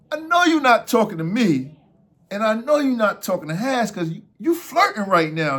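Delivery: loud, high retold challenge; indignant protest. Storyteller telling the runner he's flirting, loud indignation. Acted confrontation over flirting.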